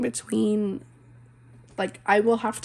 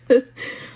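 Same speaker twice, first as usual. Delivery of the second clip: short, louder embarrassed laugh; 3 semitones higher, 7 dB louder than usual. Real, not acted. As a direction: laugh off the tears. Laugh after crying on camera.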